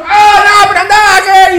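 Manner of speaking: very high, loud mock protest; indignant customer outburst. Bank customer brushing off the cashier, indignant shout. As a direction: shout over the teller, refusing to listen.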